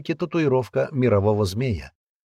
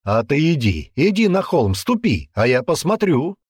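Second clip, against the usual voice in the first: raised, insistent dare; heated goading; 6 semitones higher, 4 dB louder than usual. Acted. Man daring the girl onto the hill, heated goading. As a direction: goad her to go, voice lifting. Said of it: "уже спокойнее повторил".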